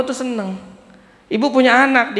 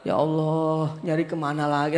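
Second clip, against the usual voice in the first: desperate soft plea; 4 semitones lower, 7 dB quieter than usual. Retold or imitated. Desperate person praying at wits' end.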